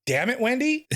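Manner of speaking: high, playful groan of blame; teasing the barber about ruined dreams; mock exasperation. Real, not acted. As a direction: throw it at Wendy, half laughing.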